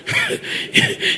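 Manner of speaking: short breathy chuckle; laughing. Real, not acted. Preacher breaks off to the congregation, chuckling. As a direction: chuckle to oneself.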